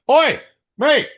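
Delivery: loud, high British yell; put-on British accent. Calling out a phone user in the cinema, loud mock-British. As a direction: holler it as an outraged Brit. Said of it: "talk to him with an accent".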